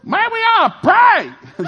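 put-on high, loud, slow kid shout; earnest small boy shouting; little boy shout. Child suggests praying.

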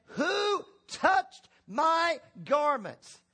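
high, slow scriptural question; solemn demand. Acted. Jesus turning to the crowd, slow high solemn demand. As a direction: draw out each word as a weighty demand.

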